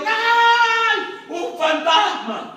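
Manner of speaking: high, loud startled shriek of fear; frightened cry. Acted. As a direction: cry out like you've just seen a ghost. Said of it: "por eso dijeron".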